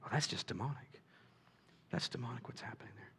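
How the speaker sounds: a critic muttering the charge of demonic; mock accusation. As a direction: hush it like a grave suspicion. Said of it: "we'll hear him say".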